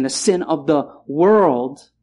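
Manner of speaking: "world" drawn out and stressed; slow, stressed "the sin of the world". Shown as a demonstration. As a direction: lean on "world" slowly. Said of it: "put the right emphasis on the right word".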